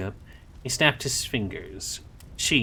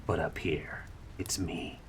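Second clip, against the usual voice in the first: whisper; 4 semitones lower, 8 dB quieter than usual. A narrator's reading. Whispered line in story.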